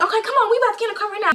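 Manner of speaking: high, rapid-fire hustle; imitated urging. Friend's mom hurrying the girls to the car, urgent.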